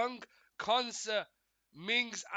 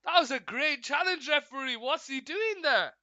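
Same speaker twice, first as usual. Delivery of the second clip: louder, higher disbelief at the referee; indignant protest; 5 semitones higher, 5 dB louder than usual. Real, not acted. Fan objecting to referee over a challenge, indignant protest. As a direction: protest the decision, voice jumping up.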